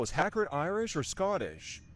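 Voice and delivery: normal talking voice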